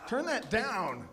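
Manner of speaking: put-on slow, cranky grandpa telling the kids off; a light take on his father's voice; grumpy old man voice. Comic telling the crowd how he sounds now, slow and cranky. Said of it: "your father's or your grandfather's voice".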